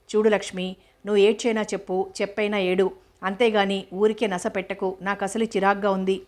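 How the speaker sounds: ordinary delivery, nothing marked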